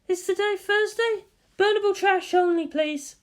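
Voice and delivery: high, lively woman's voice; bright teasing guardian; Misato voice. Acted. Misato questions Shinji at the target, high lively voice.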